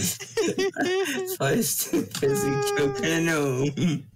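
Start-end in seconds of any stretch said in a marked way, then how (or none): 0.0-2.0 laughing. Real, not acted. Laughing fit at the table.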